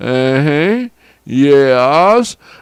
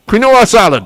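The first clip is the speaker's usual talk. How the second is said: ordinary talk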